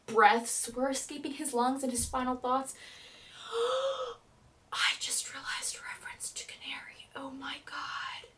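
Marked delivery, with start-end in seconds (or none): 3.4-4.1 a sudden high gasp of realization; sharp gasp. Real, not acted. Sudden realization mid-rant. 4.7-8.3 soft stunned whisper. Real, not acted. Hushed realization after gasp.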